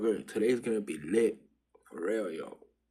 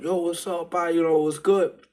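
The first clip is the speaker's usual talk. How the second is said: higher, louder mock-friendly hail; friendly greeting; 7 semitones higher, 7 dB louder than usual. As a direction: win the dog over, upbeat. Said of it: "And I'm like".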